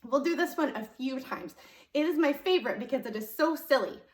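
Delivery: ordinary talk, nothing marked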